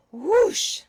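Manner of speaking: loud, high whoosh of wind. A narrator's reading. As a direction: blow it out like a gust. Wind gust sound effect.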